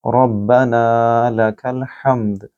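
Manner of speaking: रब्बना with a full, heavy र, slowly; slow recitation with a thick र. Shown as a demonstration. Teacher demonstrating the phrase, slow heavy r. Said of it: "यहाँ रॉ को पुर पढ़ेंगे".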